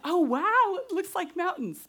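a gasp of amazement, voice lifted high and loud; amazed at clouds that look like mountains, high bright wow; retold wonder. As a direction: relive the wow at seeing the clouds. Retold awe at huge clouds.

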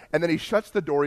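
usual speaking voice

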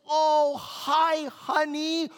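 a lightly voiced sweet wife, drawn out; sweet welcome. Wife greeting husband at the door, mock sweet. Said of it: "she says".

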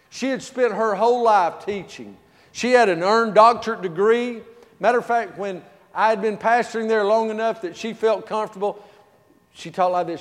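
usual speaking voice

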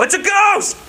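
high, frightened cry; mock fright. Disciples on the boat, seeing Jesus, high frightened cry. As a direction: shriek it in mock terror.

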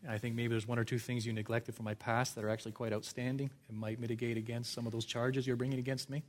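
soft, quick, casual bargaining; mock reasonable negotiator. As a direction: politely argue your case under your breath.

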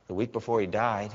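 ordinary talk, nothing marked

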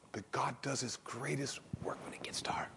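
soft whispered hope; hushed whisper. Real, not acted. Preacher whispering hope to the congregation. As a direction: lean in and whisper it.

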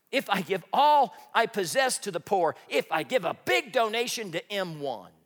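loud, high, ringing scripture reading; preaching scripture. Acted. Preacher reading Corinthians to the congregation, loud and ringing. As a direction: proclaim it to the congregation at full force.

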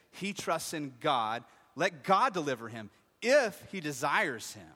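loud, drawn-out mock challenge; mocking soldiers. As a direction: jeer it slowly, leaning hard on key words. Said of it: "the soldiers mock him".

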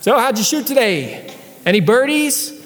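high, teasing call across the course; teasing call from afar. Pretend. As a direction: needle the bad golfer from far off. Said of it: "calls out from a hundred yards off".

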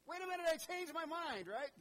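high, loud, frantic take-back; panicked backpedal. Pretend. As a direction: plead to undo it, suddenly alarmed. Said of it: "Zechariah pleading after sign".

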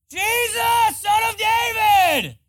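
full-force, high-pitched shout; top-of-lungs scream. Acted. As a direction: scream it out to be heard over a crowd. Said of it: "He's screaming at the top of his lungs".

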